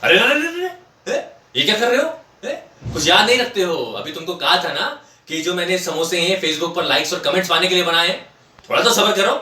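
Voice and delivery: high, loud mock screech of alarm; shrieking wife. Wife catching husband reaching for the samosas, shrieking. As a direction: imitate a wife yelling to stop him. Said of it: "हमारी बीवी ऐसे चीखी".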